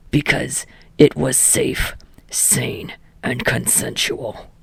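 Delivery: low, whispered deadpan answer; deadpan mutter. A narrator's reading. Katsuki answering his own question in a whisper. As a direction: mutter it under your breath, flat.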